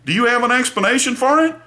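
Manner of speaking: mock friendly question from the state. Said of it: "a press release that says".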